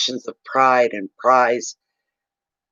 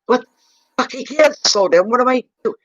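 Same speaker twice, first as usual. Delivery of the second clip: higher, louder, incredulous; exasperated inner outburst; 11 semitones higher, 3 dB louder than usual. Retold or imitated. Driver rushing for the ferry, higher and louder in disbelief. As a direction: blurt it out in disbelief. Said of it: "inside of my head, I'm going".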